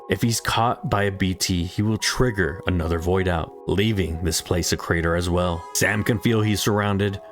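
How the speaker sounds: ordinary delivery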